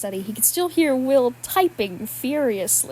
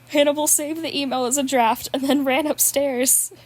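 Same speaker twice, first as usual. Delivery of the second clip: ordinary delivery, nothing marked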